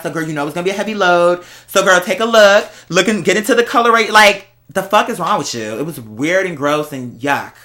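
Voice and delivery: usual speaking voice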